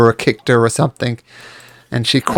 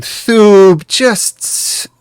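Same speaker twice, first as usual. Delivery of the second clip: a hint of a gruff master's voice; mild dismissive growl at the servant; deeper voice; 3 semitones higher, 4 dB louder, slower than usual. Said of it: "a much deeper voice".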